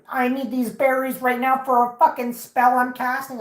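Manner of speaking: high, loud mocking imitation of a stranger; pushy berry-snatching woman. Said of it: "and she was like".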